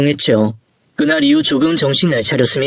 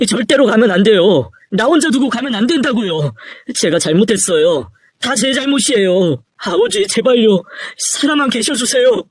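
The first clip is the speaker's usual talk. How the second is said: anguished, high-pitched begging; frantic grief, voice pushed up; desperate pleading; 6 semitones higher than usual. Acted. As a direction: beg a dying parent to hold on. Son pleads for dying father.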